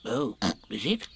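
small, uncertain piglet voice; hesitant little piglet. A narrator's reading. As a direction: ask timidly, as a very small animal. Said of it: "said Piglet".

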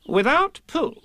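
high, emphatic pronouncement; solemn. A narrator's reading. Rabbit declares Pooh essential to the adventure, solemn. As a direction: declare it with grave importance. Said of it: "said Rabbit solemnly".

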